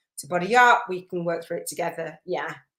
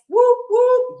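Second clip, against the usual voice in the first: cheering whoop; 9 dB louder than usual. Real, not acted. Cheering a viewer's comment.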